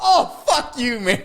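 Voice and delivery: high and laughing, playful cursing; tossing the buddy's reply out through laughter; laughing mock outrage. Retelling his Cuban buddy's reaction, high, laughing mock outrage.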